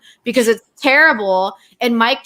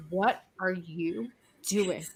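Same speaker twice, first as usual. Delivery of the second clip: slow, much softer, stern question; low deadpan scolding; 4 semitones lower, 13 dB quieter, much slower than usual. Retold or imitated. Calling partner after hearing his scream, stern and hushed. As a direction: quietly spell out your disbelief. Retold stern phone call.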